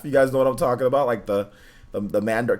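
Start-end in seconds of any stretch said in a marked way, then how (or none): none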